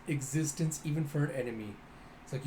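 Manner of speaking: ordinary delivery, nothing marked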